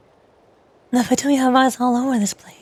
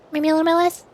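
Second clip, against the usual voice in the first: ordinary delivery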